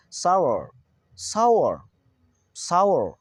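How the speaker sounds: सावर as sau-ar with a glide; correct sour pronunciation. Shown as a demonstration. Teacher drilling how to say it, सावर as sau-ar. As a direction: round u glide inside सावर, falling. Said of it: "कैसे बोलेंगे?".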